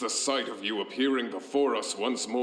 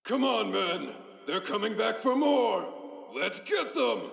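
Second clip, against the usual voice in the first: rallying cry; 10 semitones higher than usual. Acted. Rallying soldiers to charge.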